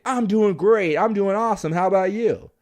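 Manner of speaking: match the caller's big energy; energetic reply; matching high energy. Shown as a demonstration. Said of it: "And I'll say".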